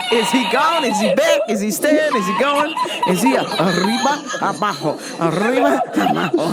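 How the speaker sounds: giggling through rapid questions; mock the logic while cracking up; laughing riff. Real, not acted. Mocking riffs while laughing.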